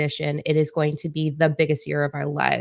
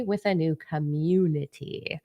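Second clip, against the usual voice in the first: whisper; 4 dB quieter than usual. Real, not acted. Whispered aside during livestream intro.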